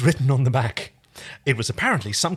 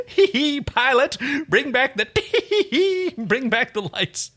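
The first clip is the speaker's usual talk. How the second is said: higher, giggling call, slowed by laughter; laughing through words; 12 semitones higher, slower than usual. Acted. John calling Pilot while giggling, higher and slow. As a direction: try to give the order but keep cracking up.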